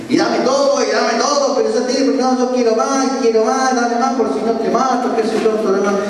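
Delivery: robber's demands. Retold or imitated. Robber demanding money at station.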